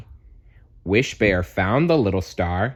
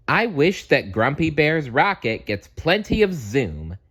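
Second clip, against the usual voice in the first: higher, eager wishing voice; hopeful wish, raised pitch; 7 semitones higher than usual. Acted. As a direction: make the wish with bright hope. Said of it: "said Wish Bear".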